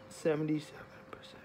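quick hushed whisper. Real, not acted. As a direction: breathe it as a whisper. Checking battery, hushed aside.

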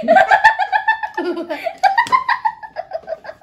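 very high squealing laugh; high shrieking laugh. Real, not acted. Laughing at the sour candy.

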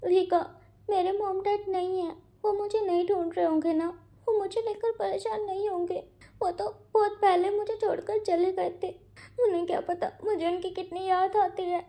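put-on high, tearful voice of a weeping young man; crying. A narrator's reading. Wang ji tells Wing about his absent parents, crying. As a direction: voice cracking as he talks of lost parents. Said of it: "वो रो रहा है".

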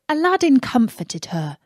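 ordinary delivery, nothing marked

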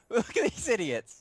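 laughing mockery, high. Real, not acted. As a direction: scoff through a laugh. Mocking the soldier figures.

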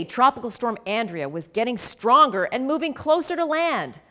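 ordinary delivery

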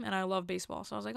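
normal talking voice

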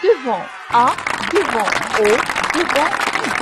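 teacher stressing the rule for pupils; emphatic rule. Shown as a demonstration. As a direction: hammer out devant A, devant O, devant U. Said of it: "إذا الجواب".

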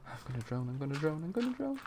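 quiet, escalating game panic; mild mock panic. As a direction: play at rising panic under your breath. Repeats fear of drowning in game.

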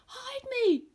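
a fleeing lion begging to be hidden; panicked lion. Acted. Frightened lion bursts in.

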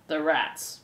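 low, solemn guard; ominous echo. A narrator's reading.

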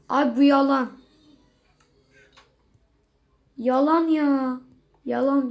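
loud frustrated complaint; fed up, calling out; exasperated. Real, not acted. Oyuna sitem etme. (sinirli sitem)

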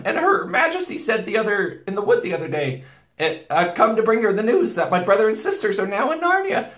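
put-on high, frightened stammering boy; trembling stammer. A narrator's reading. Boy explaining himself to the wolf, trembling and stammering. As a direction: stumble over the words in a loud, high panic. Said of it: "trembling so that he could hardly speak".

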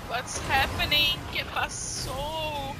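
slow drawn-out. Pretend. Slowed repeat of game line.

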